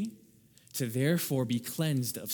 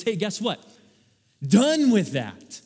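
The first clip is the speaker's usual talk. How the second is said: firm declaration; 6 semitones higher, 7 dB louder, slower than usual. Pretend. Jesus voiced declaring end of law.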